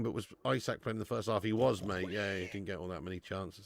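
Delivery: ordinary talk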